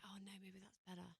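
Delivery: hushed whisper of self-doubt. Retold or imitated. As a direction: breathe it like a private worry. Inner doubt voiced as a thought.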